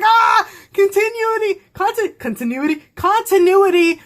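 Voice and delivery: high, loud fan squeal easing into eager repeats; excited squeal. Retold or imitated. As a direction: re-enact a delighted outburst of recognition. Her excited reaction on spotting continuity.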